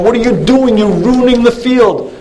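city man screaming at the farmer; mock scream. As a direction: yell it up high in alarm. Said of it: "starts screaming out".

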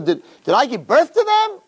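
high, incredulous rhetorical question; Moshe protesting. Retold or imitated. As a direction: imitate an indignant protest to God. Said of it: "That's not Moshe Rabbeinu talking to Hashem".

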